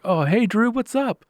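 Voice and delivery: high, loud phone hello; cheery greeting. Retold or imitated. Coworker answering Drew's call, acted as a high cheery greeting.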